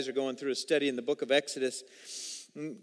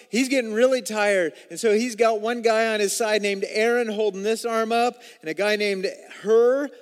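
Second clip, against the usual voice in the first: louder, driving sermon delivery; fervent preaching; 4 semitones higher, 6 dB louder than usual. Acted. Pastor telling of Moses' raised arms, louder and driving. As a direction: drive the battle story forward.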